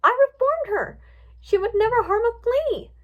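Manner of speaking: high, loud, insistent denial; shocked protest. A narrator's reading. Twilight defending the pony she reformed, shocked protest. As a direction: defend her in a high, upset voice.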